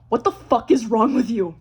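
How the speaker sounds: loud, incredulous demand; mock outburst. Girlfriend teasing her perfect boyfriend up close, mock outburst. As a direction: burst out loud in mock outrage. Sudden turn after tender compliment.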